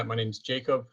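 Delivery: normal talking voice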